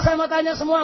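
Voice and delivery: ordinary talk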